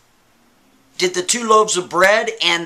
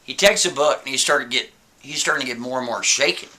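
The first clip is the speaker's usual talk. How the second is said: normal talking voice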